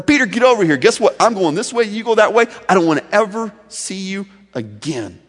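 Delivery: loud, high, angry rebuke; bringing the hammer. Pretend. Jesus scolding Peter for his denial, loud and angry. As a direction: tear into Peter.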